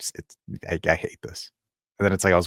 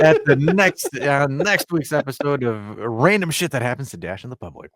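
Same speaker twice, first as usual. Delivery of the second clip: mock announcer; 7 semitones higher, 6 dB louder than usual. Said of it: "mock show title announcement".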